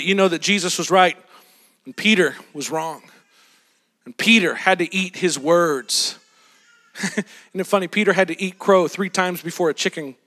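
normal talking voice

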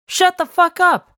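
high-pitched, punched-out command; shouting. A narrator's reading. Owner silencing his barking dog, acted shout. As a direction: shout it at storyteller volume, pitch jumping up. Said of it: "I shouted".

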